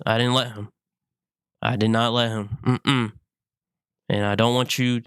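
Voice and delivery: normal talking voice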